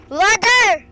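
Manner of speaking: high, loud shouted word. Real, not acted. Gamer shouts to friends about water, shouting.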